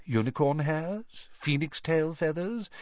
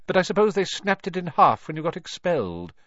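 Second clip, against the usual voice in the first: higher, pointed questioning; mildly stern old shopkeeper; suddenly stern; 7 semitones higher, 4 dB louder than usual. A narrator's reading. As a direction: turn stern on a former customer. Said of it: "suddenly stern".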